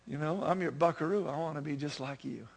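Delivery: put-on soft, unforced little-boy sincerity; tender son. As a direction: murmur it gently to Dad. Praying son's tender reply in song.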